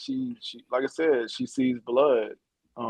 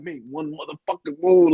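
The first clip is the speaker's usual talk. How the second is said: mock angry threat; 3 semitones higher, 6 dB louder than usual. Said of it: "She was so fucking mad".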